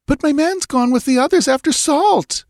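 high, wailing woman's voice. A narrator's reading. Settler woman wailing to Balthus about her husband, high. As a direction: wail it out in despair. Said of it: "she wailed".

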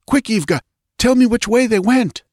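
légère voix de guerrier pressé, plus haute; vite, dis-moi; guerrier pressant. A narrator's reading. Valerius urges Ivga.

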